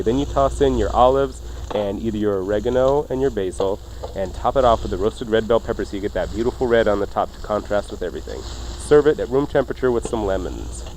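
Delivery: ordinary talk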